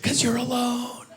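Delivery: high, drawn-out taunt; slow, high voice needling from inside; taunting inner voice. Acted. Inner voice to a lonely divorcee, taunting. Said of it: "you just hear a voice like".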